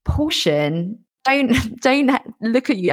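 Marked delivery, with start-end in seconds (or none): 1.2-2.9 laughing through the words; can't keep a straight face. Real, not acted. Laughing mid-advice.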